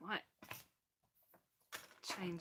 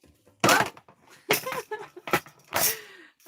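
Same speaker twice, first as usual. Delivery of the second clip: laugh; 16 dB louder than usual. Real, not acted. Laughs at the result.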